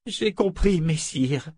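J'ai compris, messire repeated meekly with the title; submissive repetition. A narrator's reading. Claudine humbly repeating the phrase as ordered. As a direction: obediently parrot the correction. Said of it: "répéta Claudine".

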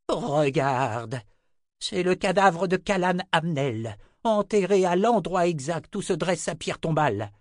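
high, loud angry rebuke; furious. Acted. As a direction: lash out at grandson.

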